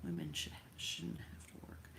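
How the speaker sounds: breathy under-the-breath correction; whispered aside. Real, not acted. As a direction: whisper it to yourself. Muttered aside correcting herself.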